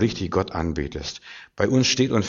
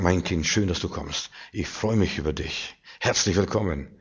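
softer, loving fatherly voice; tender welcome; 4 semitones lower, 5 dB quieter than usual. Acted. God taking the believer by the hand, soft and tender. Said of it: "an die Hand nehmen und sagen".

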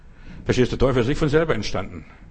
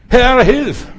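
much higher, much louder plea; desperate shout; 10 semitones higher, 10 dB louder than usual. Acted. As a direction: yell it out of the depths. Believer crying for help.

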